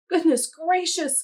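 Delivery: high, loud startled cry; cried out. A narrator's reading. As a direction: exclaim in alarm. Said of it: "cried Hen".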